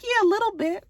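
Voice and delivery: very high, sheepish little yes; sheepish mom. Retold or imitated. Recapper voices Grace admitting she wants to party, pitched very high. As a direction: chirp it high and guilty. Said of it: "She was like".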